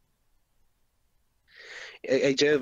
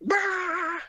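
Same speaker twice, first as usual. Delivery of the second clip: villain exclamation. Pretend.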